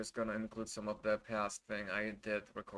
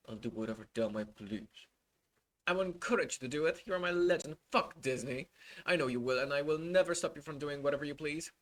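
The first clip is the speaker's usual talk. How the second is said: normal talking voice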